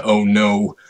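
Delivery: usual speaking voice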